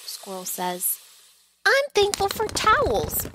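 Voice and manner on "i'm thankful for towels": much higher and louder, a happy shout. Acted. Pearl shouts happily while Stan dries them. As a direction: shout it out with joy. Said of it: "Pearl shouts".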